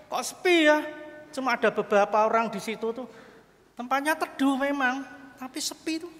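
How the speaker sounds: high-pitched puzzled complaint; mock surprised dismay. Mukidi finds heaven nearly empty, high-pitched puzzled surprise.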